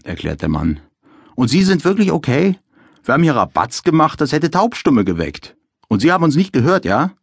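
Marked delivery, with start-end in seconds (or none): 1.3-7.1 lightly voiced officer, higher; a hint of a brisk officer. A narrator's reading. Officer questions resident.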